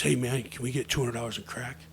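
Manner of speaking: lightly voiced low everyday voice. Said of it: "says in his normal voice".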